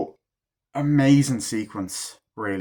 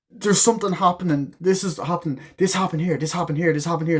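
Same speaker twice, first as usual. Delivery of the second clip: higher-pitched, repetitive mock pleading; acted urgent insistence; 6 semitones higher than usual. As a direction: press the theory urgently, imitating her. Acts woman pleading her theory.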